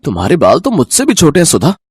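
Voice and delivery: low, quick teasing male voice; mocking husband. Husband mocks his wife's short hair, teasing. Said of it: "लेकर मजाक उड़ाने लगे".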